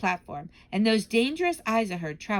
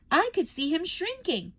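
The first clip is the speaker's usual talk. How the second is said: ordinary delivery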